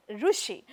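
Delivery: "Rushi" with a u vowel after the r; pronounced with ru. Shown as a demonstration. Teacher quoting how many people say it, "Rushi" with ru. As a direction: say "Rushi" as ru-shi, not ri-shi. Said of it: "A lot of people".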